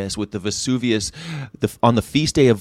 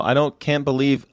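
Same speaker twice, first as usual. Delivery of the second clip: normal talking voice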